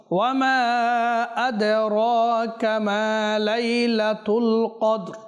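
slow melodic chant with drawn-out vowels; slow Quran recitation. Shown as a demonstration. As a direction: recite the verse slowly in tajweed. (ধীর সুরেলা তিলাওয়াত)

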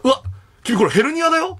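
high, startled exclamation; doctor's surprise. Retold or imitated. Chiropractor surprised at the patient's back, mock alarm. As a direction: react as if spotting something alarming. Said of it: "みたいな".

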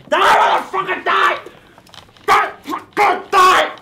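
high, loud battle cry; furious shout. Acted. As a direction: scream it as you stab. Stabbing the pumpkin.

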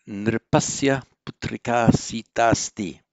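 nripasya putrika sitasti read out loud and deliberately; model reading. Shown as a demonstration. Teacher reading the Sanskrit sentence aloud to students. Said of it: "model reading of sentence".

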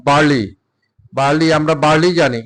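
normal talking voice

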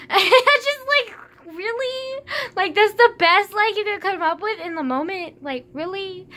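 high, loud disbelief, laughing; incredulous. Real, not acted. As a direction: shriek at your own terrible excuse. Incredulous at own bad lie.

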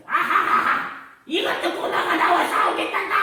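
high-pitched mocking laughter, carried into the words; hammed-up scornful cackle and taunt; jeering Goliath. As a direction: guffaw at a puny challenger. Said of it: "막 비웃기 시작하는 거예요".

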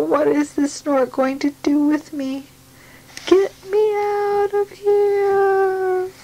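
high, slow frightened cry; a small bird begging to be let down; scared baby bird. Acted. Baby bird pleads with the snort, frightened and high.

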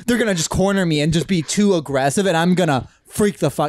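usual speaking voice

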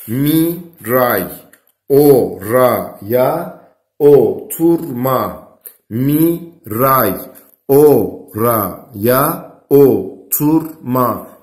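slow dictation, each word stressed; dictating. Shown as a demonstration. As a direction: dictate slowly and clearly. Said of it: "bir cümle daha oluşturalım".

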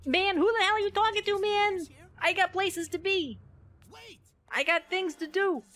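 nervous sidekick pleading over a zombie outbreak; panicky dubbed sidekick. Pretend. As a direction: voice the character as a nervous wreck. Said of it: "let's make him like".

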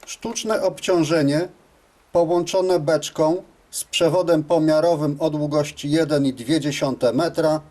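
usual speaking voice

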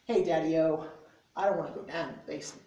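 loud, punchy slam poetry delivery; spoken word poem. Acted. Reading his poem to friends in a bookstore, loud slam declamation. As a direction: declaim it like a slam poet calling out the crowd.

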